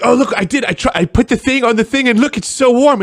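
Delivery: hammed-up delighted internet tinkerer; mock gullible vlogger. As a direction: play a proud clueless DIYer. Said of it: "They're like".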